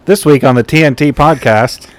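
loud, hyped announcer voice. Pretend. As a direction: announce it like a show intro. Mock show announcement.

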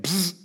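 high quick psst sound; imitated instant-miracle hiss; magic zap hiss. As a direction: make a little magic zap.

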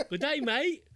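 high, hammed-up Australian accent; bad Australian accent. Friend quoting the movie's bad Australian accent line. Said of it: "Completely wrong country".

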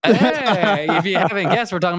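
high, loud hype-man shout; pumped intro. Acted. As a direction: announce it like a hyped host.

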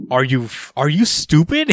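high-pitched, jumping up on the question; mock incredulous scolding. Daemon pulling up on Code, mock incredulous. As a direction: act out an appalled telling-off, half amused.

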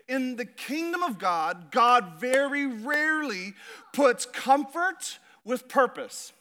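rising pulpit conviction; preaching. Acted. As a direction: drive each phrase home slowly. Preaches the main point.

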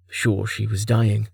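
ordinary delivery

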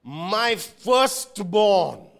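very slow, loud declaration; solemn proclamation. Acted. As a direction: proclaim every word slowly. God's declaration to Pharaoh.

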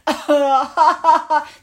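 loud, high imitated hyena laughter; hyena cackle. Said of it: "they couldn't stop laughing".

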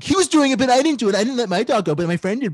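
mock tattletale blaming the friend; whiny snitch. Imagined snitching on his friend, high and whiny. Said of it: "I wasn't like".

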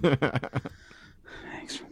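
slow, breathy whispered thanks. Acted. Co-host sarcastically thanking his partner, in a slow whisper. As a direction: whisper it slowly, half mocking. Teasing co-host after compliment.

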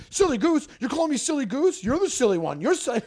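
mock indignation, pitched high; high, incredulous retort; affronted comeback. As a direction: imitate someone stung by a childish insult. Acts out telemarketer's affronted comeback.

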